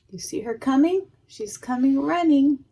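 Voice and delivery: plain reading voice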